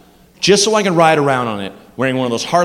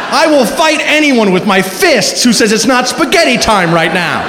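excited vow; 6 semitones higher than usual. Real, not acted. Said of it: "comic vow".

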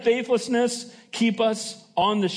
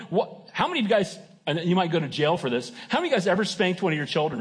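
usual speaking voice

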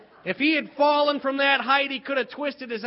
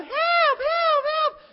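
put-on high, loud kids' cry for help; shouting for help; 6 dB louder than usual. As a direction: yell it like kids in mock danger. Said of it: "So we were yelling".

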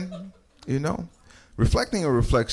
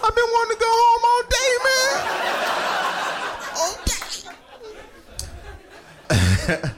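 whiny GPS voice; faster than usual. Retold or imitated. GPS voice complaining, whining.

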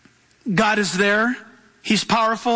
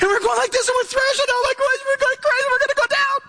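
high-pitched acted panic; screaming emotions. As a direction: thrash about in a frantic, hammed-up squeal. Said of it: "your emotions screaming at you".

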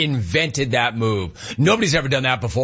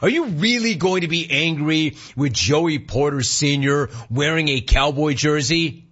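ordinary talk, nothing marked

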